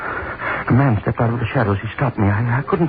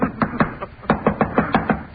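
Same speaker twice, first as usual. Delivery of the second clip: mad laugh; 11 semitones higher than usual. Acted. Madman laughs before dying.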